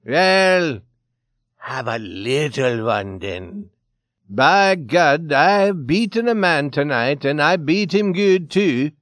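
loud character voice, coaxing then bragging; big boastful Swede voice. A narrator's reading. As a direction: brag loudly over a drink.

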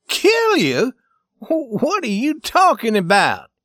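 very high, loud, rising question; incredulous shout. Acted. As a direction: stammer out loud disbelief. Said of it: "shouted Scully".

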